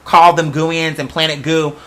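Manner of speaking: ordinary delivery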